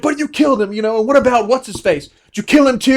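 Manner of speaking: high, loud accusing voice; angry accusation. Retold or imitated. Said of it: "He was like".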